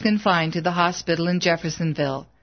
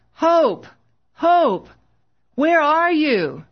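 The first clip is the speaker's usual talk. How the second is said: frantic shout; 9 semitones higher, much slower than usual. A narrator's reading. Husband searching a crowded ward for his wife, frantic shout. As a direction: yell her name, frantic with fear. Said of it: "Bill shouted frantically".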